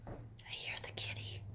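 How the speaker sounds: unvoiced whisper. Real, not acted. Whispering to the dog about the kitty. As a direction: hush it right down.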